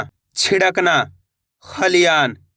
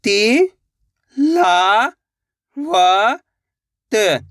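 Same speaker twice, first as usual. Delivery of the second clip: ती, ला, व, त each stretched and apart; drawn-out syllables; 2 semitones higher, much slower than usual. Shown as a demonstration. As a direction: pull out each sound like a teacher. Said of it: "खींच के पढ़ेंगे". (हर अक्षर खींचकर बोलना)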